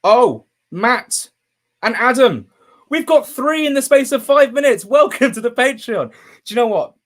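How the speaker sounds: high, loud burst of real joy; overjoyed shout. Real, not acted. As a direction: cry out in real delight at the news. Three new subscribers at once.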